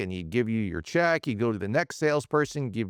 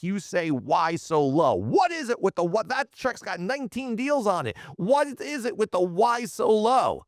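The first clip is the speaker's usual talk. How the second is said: higher, heated protest, pitch climbing; indignant complaint; 7 semitones higher than usual. Retold or imitated. Young salesman confronting his manager about the check, heated and higher. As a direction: demand an answer, fed up and rising.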